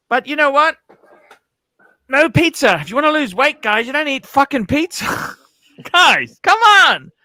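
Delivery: high, loud ranting. Real, not acted. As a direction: shout it at the guys. Ranting at viewers about pizza.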